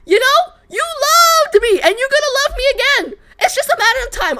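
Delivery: sing-song telling a lost lover the truth; acted love confession. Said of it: "I'ma fucking tell them".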